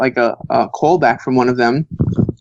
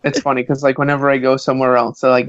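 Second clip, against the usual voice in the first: normal talking voice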